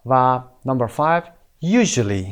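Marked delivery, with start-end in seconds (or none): 1.6-2.3 "usually" with a peak on the opening U, then falling; teacher's model "usually", first syllable strongest; stress on first syllable. Shown as a demonstration.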